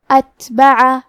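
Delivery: اتبع with the ت breathed out; tajweed demo. Shown as a demonstration. Teacher modelling اتبع for students, crisp and clear. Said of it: "تو ہمیں یہ کلمہ کو ایسے پڑھنا ہے".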